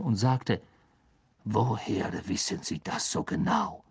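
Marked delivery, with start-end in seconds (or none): 1.4-3.8 lightly lowered, quiet suspicious question; a touch of cold suspicion; menacing professor asking, softly; a hint of a cold Snape. A narrator's reading. Snape challenges McGonagall.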